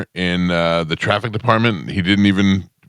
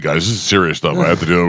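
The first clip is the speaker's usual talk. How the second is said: ordinary delivery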